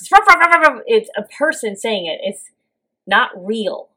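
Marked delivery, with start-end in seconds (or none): 0.1-0.8 loud high human ruff ruff; mock dog barks. Imitating the movie's human dog sounds, loud high barking. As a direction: bark it like a person faking a dog. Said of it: "when the dog barks".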